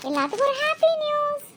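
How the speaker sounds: very high, singled-out gleeful cry; excited announcement. Acted. Girl announcing happy news to just-woken friends, excited high call. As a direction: proclaim it to the whole room in a soaring pitch.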